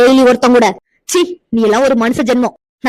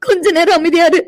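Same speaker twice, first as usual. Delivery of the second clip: slow, higher, crying; sobbing plea; 5 semitones higher than usual. Acted. Amirtha sobs, begging her friend to stop. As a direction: plead through broken sobs.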